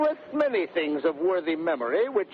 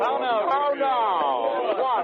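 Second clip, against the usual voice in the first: brusque hail; 6 semitones higher, much slower than usual. Acted. Grumio greeting servants brusquely.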